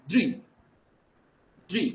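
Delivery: "দৃ" as one quick syllable dri; fuse d and ri in "দৃ", standing alone; joined sounds. Shown as a demonstration. Teacher models the syllable for student, d and ri joined. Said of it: "দ এবং রি একসঙ্গে".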